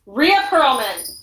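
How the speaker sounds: loud, drawn-out full-name call; scolding the cat. Real, not acted. Cat owner yelling at the misbehaving cat, loud scolding. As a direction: call out the cat's full name sharply, stretching it.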